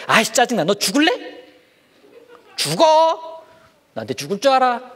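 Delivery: put-on high, loud childish threat; mock threatening. Child threatening a friend, high and loud mock anger. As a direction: snarl like a kid picking a fight. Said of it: "무서운 말로 막 이렇게 다투려고".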